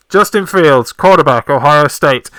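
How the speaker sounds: loud, emphatic pick announcement; draft announcer. Acted. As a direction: boom out the name like a draft announcer.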